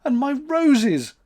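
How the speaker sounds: high, slow tearful wail; weeping. A narrator's reading. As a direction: mourn the lost roses. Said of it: "wept June".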